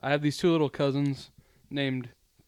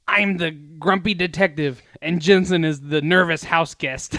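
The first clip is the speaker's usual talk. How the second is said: slow, louder mock-kid declaration; little cousin announcing himself; 5 dB louder than usual. Little cousin announces roles to older relative, slow proclamation. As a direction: proudly announce the game, drawn out. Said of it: "The first thing he says, he's like".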